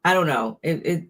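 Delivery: ordinary talk, nothing marked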